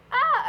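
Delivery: high sudden squeal; excited yelp. Real, not acted. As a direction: let out a sharp happy squeal.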